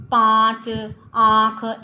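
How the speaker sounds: पाँच and आँख called out loud with the nasal vowel; teacher calls out पाँच and आँख to show chandrabindu; stressed example words. Shown as a demonstration. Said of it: "इन शब्दों में चंद्रबिंदु का प्रयोग".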